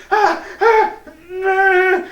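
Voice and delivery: laughing hammed-up moans; mock groans. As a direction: groan as if scratching hurts. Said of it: "you're like".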